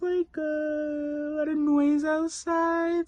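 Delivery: very high, breathy, hesitant falsetto with long pauses; exaggerated polite coworker. Shown as a demonstration. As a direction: squeak it out as an over-apologetic colleague. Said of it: "There was the timing".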